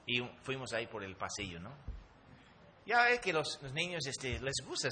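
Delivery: ordinary delivery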